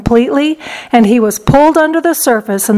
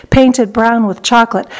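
usual speaking voice

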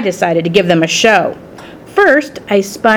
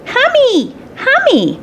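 put-on high-pitched toddler call; little voice calling out; slower than usual. Toddler calling the new hamster by name, high little voice. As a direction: call out in a tiny, high child's voice. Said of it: "a little voice called out".

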